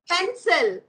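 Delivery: ordinary talk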